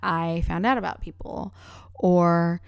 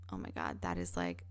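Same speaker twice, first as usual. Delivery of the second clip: low hushed whisper; 13 semitones lower, 12 dB quieter than usual. Real, not acted. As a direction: murmur it to yourself. Whispered self-brag.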